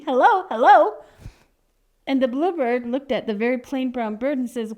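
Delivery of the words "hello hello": high, loud hello; calling out. Acted. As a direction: call out for attention, loudly. Bird calls out a greeting.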